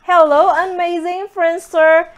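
bright upbeat hello; excited greeting. Real, not acted. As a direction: greet them with a big rise. Vlog greeting, high and loud.

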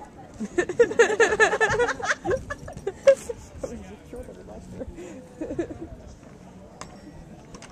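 long maniacal laugh; evil cackle. Acted. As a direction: cackle like a cartoon villain.

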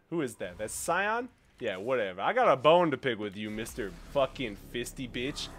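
ordinary talk, nothing marked

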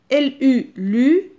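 L U spelled loud and high, lu sounded out; spell, then say it; spelled-out syllable. Shown as a demonstration. Teacher models a syllable for children, loud. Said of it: "je vais faire le premier exemple avec vous".

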